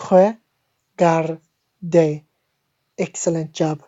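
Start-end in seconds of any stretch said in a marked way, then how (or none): 0.1-1.4 "regarde" in separated syllables, throat R then tongue-tip R, ending "day"; French pronunciation. Shown as a demonstration. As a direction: lead a repeat-after-me "regarde", khuh, garr, day. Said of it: "Say it with me".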